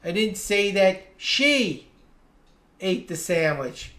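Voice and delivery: "she" stretched and lifted, then a pause; single out "she", drop the rest; stress on SHE. Shown as a demonstration. Teacher showing it was someone else, stress on "she". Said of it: "I changed the pitch of my voice".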